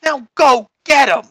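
crime boss summoning dim henchmen; commanding godfather; mafia Don. Acted. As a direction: order your goons after the runaways. Don orders his henchmen.

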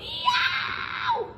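put-on very high yell, storybook boy; a sudden high "Yow!"; high-pitched yelp of pain. A narrator's reading. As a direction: yelp from biting on a sore tooth. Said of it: "He took a bite and yelled".

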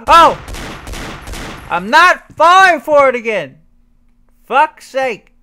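loud high exasperated shout; frustrated yelling. Real, not acted. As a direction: yell in real annoyance. Frustrated at game trap.

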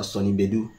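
Bedu with an E vowel in the first syllable; alternative pronunciation. Shown as a demonstration. YouTuber puzzling over the singer's name, offering an alternative pronunciation. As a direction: say Bedu as "BEH-doo". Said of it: "Wait, is it [name]".

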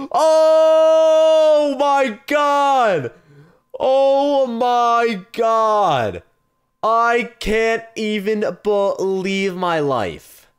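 long, high, loud wail of amazement; overwhelmed screaming. Real, not acted. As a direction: stretch every word out in ecstatic disbelief. Stunned celebration of the win.

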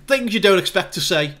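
ordinary talk

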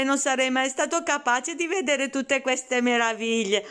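ordinary delivery

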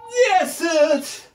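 pushed-out final reps; straining count. Real, not acted. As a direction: squeeze the words out with effort. (с натугой)

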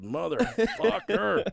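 slow, drawn-out laughing curse. Retold or imitated. Teller alone in the bathroom, cursing slowly through a laugh. Discovering the mess.

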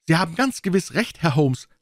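high, eager woman's voice; young governess, excited and keen. A narrator's reading. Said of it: "rief Fräulein Hunter aus".

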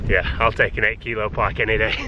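normal talking voice

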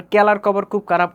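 Mymensingh plain k for each kh; Mymensingh accent. Retold or imitated. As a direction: drop the puff, Mymensingh style. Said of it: "ওইটাও হবে না". (ময়মনসিংহের টানে ক দিয়ে)

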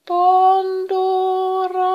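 very high, loud, held wail; crying out. A narrator's reading. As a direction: call the name as one long plaintive note. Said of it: "ne huusivat jälleen". (korkea, pitkä valittava huuto)

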